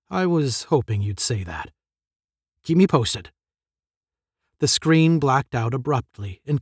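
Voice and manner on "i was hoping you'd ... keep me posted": ordinary delivery, nothing marked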